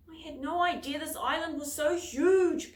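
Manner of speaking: puffing. A narrator's reading. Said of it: "puffed Henry".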